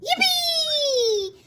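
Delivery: high, gleeful shout. A narrator's reading. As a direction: squeal with joy. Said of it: "Peppa shouts".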